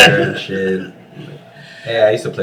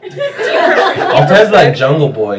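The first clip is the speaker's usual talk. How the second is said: laughing; 7 semitones higher, 7 dB louder, much slower than usual. Real, not acted. Laughs while saying it.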